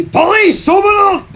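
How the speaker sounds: loud high police bark at the door; police shout. Acted. Pretending to be cops, shouting at the door. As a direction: bang on the door and yell police.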